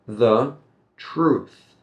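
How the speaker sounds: truth set apart, closing on a clear TH; careful TH ending. Shown as a demonstration. Teacher shows T H at the end, careful articulation. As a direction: separate the words and finish truth on TH. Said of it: "So that T H right at the end".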